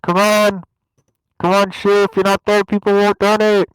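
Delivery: yelling to wake someone up; very loud. Acted. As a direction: shout it, high and loud. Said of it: "I'm going to be very, very loud".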